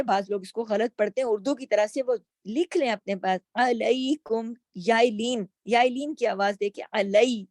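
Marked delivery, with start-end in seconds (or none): none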